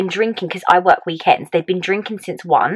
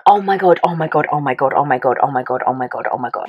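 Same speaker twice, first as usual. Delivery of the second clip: panicked muttered loop, sinking lower; retold panic; 4 semitones lower, faster than usual. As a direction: repeat it in a stream, dropping in pitch. Retold panic at positive test.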